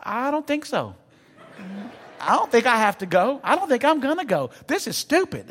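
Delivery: high, panicky mock refusal, retold; scared refusal. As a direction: whine it like a man frozen on a high ledge. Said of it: "I'm afraid of heights".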